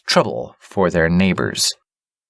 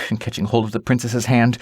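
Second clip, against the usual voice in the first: usual speaking voice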